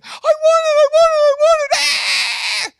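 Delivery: high, loud tantrum wail; shrieking supermarket tantrum; screaming toddler. Acted. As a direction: scream like a three-year-old demanding a treat. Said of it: "is in the cereal aisle screaming".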